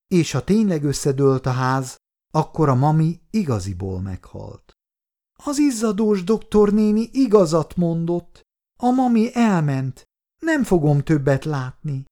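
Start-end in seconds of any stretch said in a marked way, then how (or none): 5.4-12.0 put-on high child's voice, understated sorrow; grieving little girl. A narrator's reading. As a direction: let the loss sink in as a small child. Child realizes mother is dead. (szomorú kislányhang)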